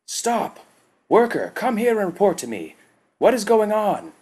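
high, loud barked orders; commanding shout. A narrator's reading. Tagath halts the worker at the heap, shouting orders. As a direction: stop him in his tracks, full command. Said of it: "commanded Tagath".

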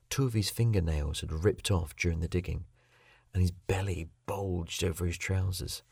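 ordinary delivery, nothing marked

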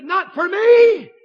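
slow, loud, high "Not for me"; shouted refusal. Acted. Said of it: "David could have said".

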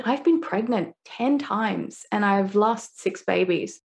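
usual speaking voice

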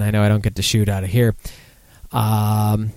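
ordinary delivery

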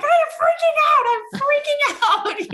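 very high, loud hammed-up panic; panicked shriek. Client given permission to speak, panicked shrieking. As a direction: shriek it, breaking into a laugh.

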